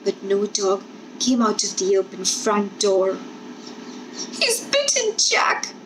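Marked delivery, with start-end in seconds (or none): 4.4-5.7 put-on high, tearful child's cry; a little girl sure her dog is bitten. A narrator's reading. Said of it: "the small girl cried".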